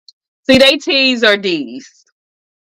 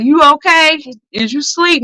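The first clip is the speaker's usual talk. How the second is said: concerned friend; 8 semitones higher than usual. Retold or imitated. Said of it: "they be like".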